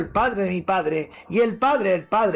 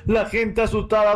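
plain reading voice

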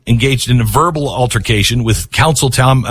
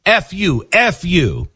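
retold scream; 5 semitones higher, slower than usual. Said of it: "Basin screams".

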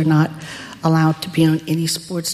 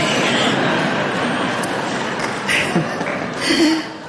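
high, breathy burst of real laughter; 12 semitones higher than usual. Real, not acted. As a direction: laugh out at how absurd it was. Laughing at the absurd memory.

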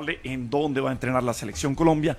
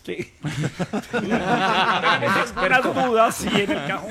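laughing; 4 semitones higher, slower than usual. Real, not acted. Joking about Pinzón's hometown.

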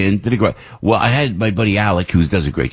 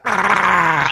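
high pump drone, sliding slightly down; sustained high motor noise by voice; imitated loud pump; 10 semitones higher than usual. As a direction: imitate the noisy pump in a short burst. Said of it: "they're so loud, it goes".

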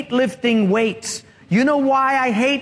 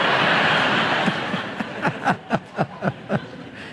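soft laugh; 4 dB quieter than usual. Real, not acted. Speaker laughs at own joke.

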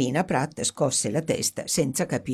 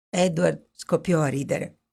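usual speaking voice

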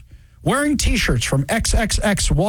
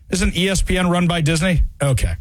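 ordinary talk, nothing marked

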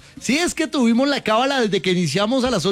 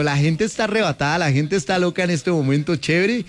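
usual speaking voice

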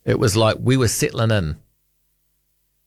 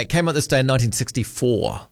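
ordinary talk, nothing marked

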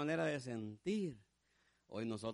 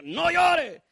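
high, loud order; stern command; 13 semitones higher, 15 dB louder than usual. Acted. Hard heart forbidding a man to cry, stern loud command. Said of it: "que le dice".

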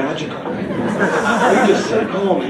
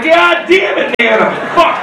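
higher, alarmed cry at Nana; mock panicked outburst; 9 semitones higher than usual. Viewer at home yelling at Nana, panicked. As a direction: yelp it like she's about to fall. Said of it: "You're like".

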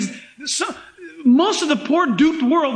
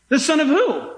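ordinary talk

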